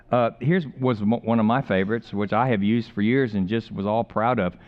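ordinary talk

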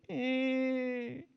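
put-on a whiny little kid's fed-up grunt; lightly voiced toddler refusing; grumpy toddler eh. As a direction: mimic a kid who's done playing. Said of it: "he goes".

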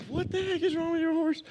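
very high-pitched mock bafflement; incredulous exclamation. Husband baffled by his wife's horse, high incredulous cry. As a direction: squeal the question in disbelief, without shouting. Exasperated question to wife, retold.

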